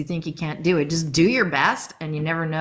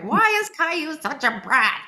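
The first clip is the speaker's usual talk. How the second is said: higher, whiny grievance; mock annoyed parent; hint of an irate parent; complaining parent; 9 semitones higher than usual. Imitating critics.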